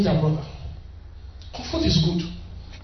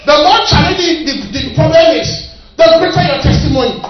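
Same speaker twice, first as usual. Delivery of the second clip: louder restatement; 4 semitones higher, 6 dB louder than usual. Shown as a demonstration. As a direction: repeat it louder, hammering it home. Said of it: "you didn't get me".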